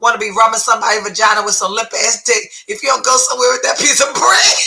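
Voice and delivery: ordinary talk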